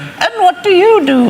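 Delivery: high, slow, prim royal voice; imitating the Queen. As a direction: ask it like a gracious royal. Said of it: "she just went".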